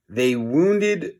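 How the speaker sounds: ordinary delivery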